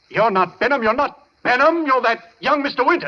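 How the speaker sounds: furious realisation. Acted. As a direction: unmask the impostor with rising outrage. Doctor discovers the deception.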